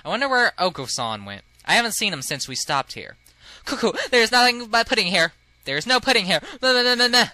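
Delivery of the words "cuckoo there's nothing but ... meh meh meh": loud, high cuckoo character; high, grating cartoon bird; annoying bird voice. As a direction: squawk it like an excitable pudding-mad bird. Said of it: "I don't know why I gave him that voice".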